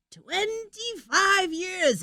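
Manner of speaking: slow, high cackling witch. Pretend. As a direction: stretch it out like an old witch. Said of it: "I'll put my, my witch voice for that".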